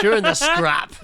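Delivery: high, loud mock newsreader. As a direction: announce it in a hammed-up news voice. Mock news read, repeated higher.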